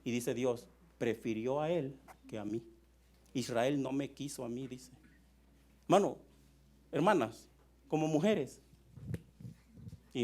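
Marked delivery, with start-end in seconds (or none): 1.0-4.7 saddened God. Acted. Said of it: "Pero lo más triste".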